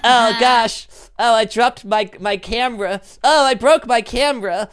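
high, whiny, clumsy character voice; Gary. Acted. Player performing an impression to impress Aphmau, high fretting voice. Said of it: "no Gary impression".

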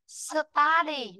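"Study" with an open "uh" first vowel, "STAH-dee"; correct pronunciation. Shown as a demonstration. Teacher corrects the pupil's vowel in study, correct pronunciation. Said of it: "not study".

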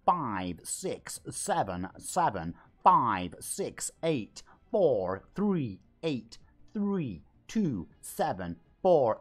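robotic digit-by-digit readout; text-to-speech. Shown as a demonstration. Streamer mocking spam in chat, machine-like number reading. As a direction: recite each number separately with the same falling tune. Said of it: "example of what happens with the text-to-speech".

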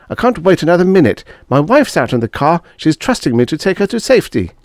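high, impatient, quick at first; snapping. A narrator's reading. Martin's wife waits in the car, impatient snapping. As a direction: press him with urgent impatience. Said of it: "snapped Martin".

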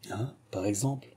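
a touch of caught-out hesitation; slightly hesitant. A narrator's reading. Brother caught out by his sister, slightly hesitant. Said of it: "d'un ton légèrement hésitant".

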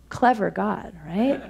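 usual speaking voice

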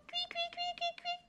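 high, soft chirping; bird chirping. A narrator's reading. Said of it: "l'oiseau piaille".

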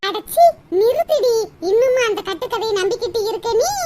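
loud scornful snap with a laugh; angry scoff. Acted. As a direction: shut her up mockingly. Sister cuts off naive girl.

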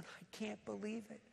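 very soft, lightly acted amazement through tears; imitated tearful disbelief. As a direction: say it small, imitating someone overcome. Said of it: "And in tears, he's going".

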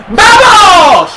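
loud, high triumphant yell; excited shout. Real, not acted. Gamer cheering a goal to his stream, yelling. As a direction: roar it out as the goal lands.

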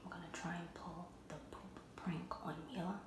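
hushed prank whisper. Real, not acted. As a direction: whisper so she won't hear. Sneaky intro before the prank.

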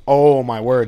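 loud burst of delight; excited exclamation. Real, not acted. Player reacts as the dog returns with supplies, loud excited exclamation. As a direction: shout it out when the good news lands. Dog brings items home.

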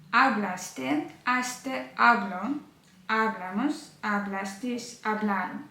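normal talking voice

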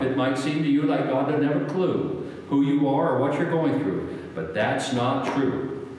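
ordinary talk

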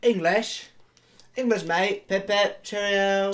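high, loud hammed-up British accent; mock British accent. Mock Britishisms after talk of English.

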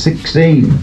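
ordinary delivery

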